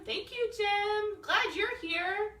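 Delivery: excited. Real, not acted. Warm welcome to a guest.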